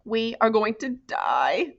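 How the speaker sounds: tense hissed warning, slow; frightened hiss, drawn out. A narrator's reading. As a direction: hiss it into his ear, scared. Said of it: "Grace hissed in Marty's ear".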